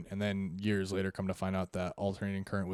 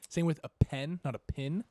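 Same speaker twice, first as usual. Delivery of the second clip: pen and pin punched, higher and louder; contrastive vowels; 7 semitones higher, 5 dB louder than usual. Shown as a demonstration. Correcting the co-host, pen set against pin. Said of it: "It's an I".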